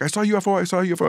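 very high, breathless excited cry. Retold or imitated. Retelling how he ran around yelling, very high excited cry. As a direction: blurt it like a kid who can't contain it. Running around shouting about UFO.

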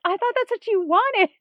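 high, loud, wide-eyed innocence; mock innocent David. Retelling the wedding scene, David's high innocent protest. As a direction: play dumb, sweetly baffled.

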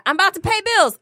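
other partner. Acted.

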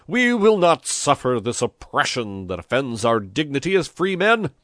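loud, high rabble-rousing oratory; fiery, declamatory political speech; shouted speech. A narrator's reading. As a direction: proclaim it to a crowd from a balcony. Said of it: "shouted Pepone".

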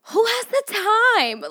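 high, slow exasperated question. Real, not acted. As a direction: whine it, fed up. Complaining about jury duty.

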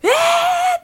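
high, loud cry of shock; a sudden shriek of disbelief. Retold or imitated. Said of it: "って言って". (驚いた甲高い叫び声)

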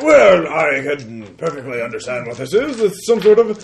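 high, loud put-on voice; pompous clever character. Character examining the rocket tracks, high pompous voice. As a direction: declare it in a high, fussy voice. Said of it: "He looks at".

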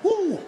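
high, loud "woo" over the food; loud eating whoop. Retold or imitated. Comic mocking a sweaty eater, high whoop. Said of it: "while they eat, they're like".

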